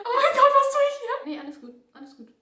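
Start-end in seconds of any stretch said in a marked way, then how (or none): 0.0-1.2 very high, loud outburst; panicked shriek. Real, not acted. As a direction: shriek in overwhelmed despair. Overwhelmed by the crying doll.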